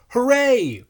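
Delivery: loud, high cheer; shouted hooray. A narrator's reading. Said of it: "we shouted".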